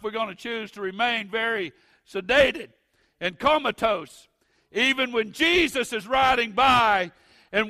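loud, high, building preacher's cadence; preaching at full force. Acted.

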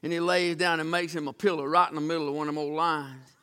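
normal talking voice

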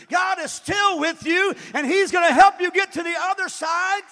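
loud, high preaching shout; fervent declaration. Acted. Believer preaching to self about God's presence, fervent shout. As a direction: proclaim it with building conviction.

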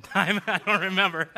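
laughing. Real, not acted. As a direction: laugh at yourself while you admit it. Laughing at throwing the phone.

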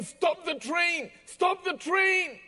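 high, slow urgent calling out; urgent cry. Retold or imitated. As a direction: cry out a desperate warning. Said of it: "an urgent voice that cried out to him".

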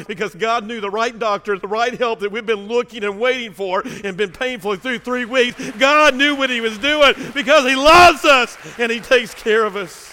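loud, high, building preacher's cry; full-force preaching. Real, not acted. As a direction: rise into a roaring climax of praise. Sermon builds to climax.

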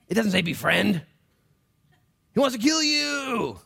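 high, loud preacher's cry, stretched at the end; shouted, high-pitched alarm; dramatic warning. Acted. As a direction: cry out a warning at full force. Preacher dramatically corrects befriend to devour.

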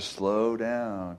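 "slow down" said slowly, easing off; drawn-out slowing down. Shown as a demonstration. Telling church about adjusting to the beach, drawn-out words. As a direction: stretch out "slow down".

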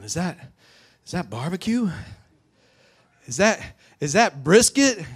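high, rising hopeful questions; getting excited. Acted. Brother nearing home smells barbecue, getting excited. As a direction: wonder aloud with growing excitement. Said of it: "He's starting to get excited".

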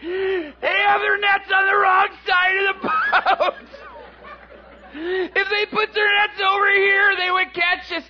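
high, loud, gleeful squeal; giggling fish. Acted. School of fish laughing at the fishermen, high gleeful squeal. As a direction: squeal it like mocking little creatures.

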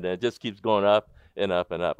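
normal talking voice

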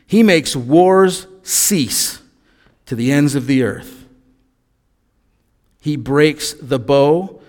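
slow, solemn reading of a psalm; scripture reading. Acted. Pastor reading the psalm to the congregation, slow and solemn. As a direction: let each line land slowly and reverently.

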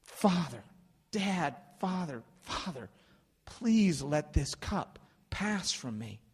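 slow, emphatic begging; acted heartfelt plea to a father; intimate, persistent pleading. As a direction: plead personally, each address set apart. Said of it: "very intimate way of saying".

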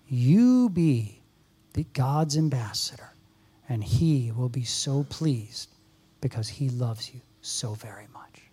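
soft, whispered close; hushed tender appeal. Real, not acted. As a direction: whisper the blessing gently.

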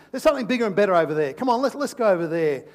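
fast, loud coaxing; urging tempter. Acted. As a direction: hurry them off, loudly. Enemy urging them to leave.

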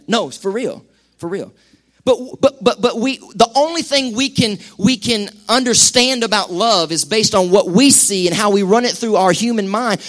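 normal talking voice

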